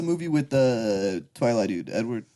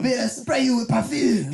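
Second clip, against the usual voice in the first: mock French skunk; 7 semitones higher than usual. Imitating Pepe Le Pew.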